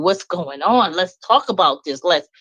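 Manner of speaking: usual speaking voice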